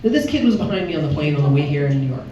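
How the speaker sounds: ordinary talk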